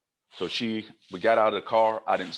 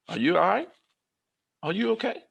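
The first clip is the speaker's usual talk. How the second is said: concerned surprise; 10 semitones higher than usual. Retold or imitated. Shop director surprised, concerned.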